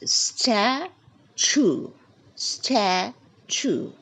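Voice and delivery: "statue" in two slow beats, STA... choo, first part high; stress on STA. Shown as a demonstration. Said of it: "We stress on the first three letters".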